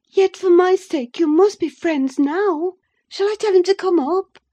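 high, loud, eager pleading; barely repressed delight. A narrator's reading. Wife begs husband to be friends, high eager delight. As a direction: press him eagerly, pitched high. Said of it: "repressing a little the intensity of her delight".

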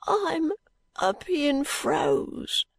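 high, very drawn-out suffering voice; hoarse sick boy. A narrator's reading. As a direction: drag it out high as an ailing boy. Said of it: "returned the boy hoarsely".

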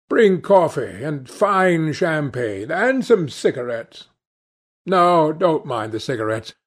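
breezy, commanding Lord Henry. A narrator's reading. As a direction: order grandly, then pronounce with assurance. Lord Henry orders, then holds forth.

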